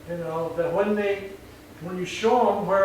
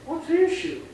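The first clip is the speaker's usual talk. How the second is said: relaxed hey man; 7 semitones higher, 5 dB quieter than usual. Shown as a demonstration. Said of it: "you gotta be going".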